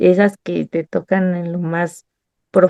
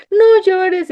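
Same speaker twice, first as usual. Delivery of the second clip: mock husband; 10 semitones higher than usual. Husband comforting, voiced high.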